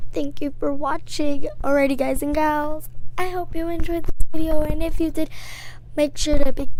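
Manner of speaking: a light hint of a hyped YouTuber signing off; YouTuber outro voice. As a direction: play a typical channel host wrapping up. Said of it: "wait, I'm impersonating".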